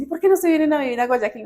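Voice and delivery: high, fast coaxing question; quick high-pitched imitation of a daughter urging her parents to move; mimicking the daughter's question. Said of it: "siempre nos dicen".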